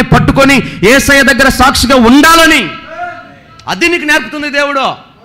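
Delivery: high, loud pulpit cry; fiery preaching. Acted. Preacher urging believers to hold their faith, loud and high. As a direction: build it into a rousing exhortation.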